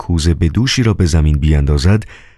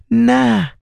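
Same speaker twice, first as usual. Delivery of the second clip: high, teasing female voice; coquettish woman. Acted. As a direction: flirt and tease him, pitched up. (نیزا با عشوه و صدای زیر زنانه)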